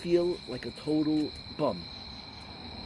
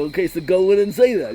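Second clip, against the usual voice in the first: loud, insistent push to go do it; retold loud urging; urging shout; 4 semitones higher, 10 dB louder than usual. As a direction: shout the dare at him, half laughing. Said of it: "So I go".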